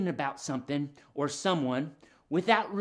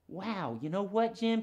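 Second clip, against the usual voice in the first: ordinary talk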